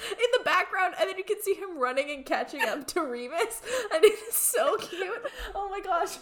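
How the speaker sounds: high, loud, laughing gush; laughing excitedly. Real, not acted. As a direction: gush through the giggles. Gushing while laughing.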